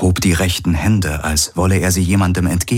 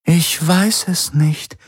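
slow, high-pitched regretful voice; sincerely saddened; 10 semitones higher, slower than usual. A narrator's reading. The Helix answers Garland, sincerely saddened. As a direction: admit it slowly with honest regret. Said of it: "Sie klang ehrlich betrübt".

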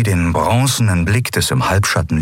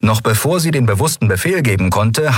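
usual speaking voice

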